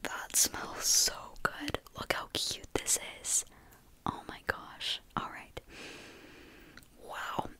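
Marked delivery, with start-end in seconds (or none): none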